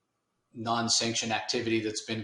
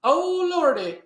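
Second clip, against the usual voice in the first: much higher, louder old-lady voice, hammed up; flustered grandmother; 9 dB louder, slower than usual. Grandmother gushing to Al about Wanda, in a high, loud hammed-up granny voice. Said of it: "she just freaks out".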